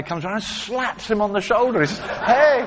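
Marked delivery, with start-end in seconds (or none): 2.0-2.7 playful hey. Retold or imitated. Bouncy guy slaps his shoulder.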